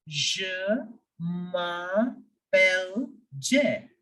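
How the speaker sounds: "Je", pause, slow "m'appelle Jay"; slow French demonstration. Shown as a demonstration. Teacher introducing himself in French, slowly in pieces. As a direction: model it slowly, word by word. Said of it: "So I will say it as".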